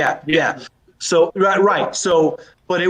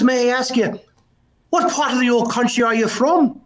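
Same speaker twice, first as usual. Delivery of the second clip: higher-pitched joke Irishman; Irish brogue; 6 semitones higher than usual. Acted. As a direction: ask politely in an Irish brogue. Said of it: "a bit of a brogue".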